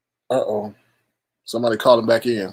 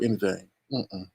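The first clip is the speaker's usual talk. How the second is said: normal talking voice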